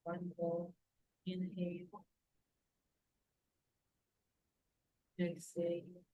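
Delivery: calm breathing guide, unhurried; guided meditation. Acted. Leads a guided breathing relaxation.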